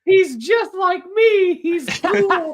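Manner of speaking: high, drawn-out dopey voice; high slow goofy voice. Retold or imitated. Mock self-identification, high slow.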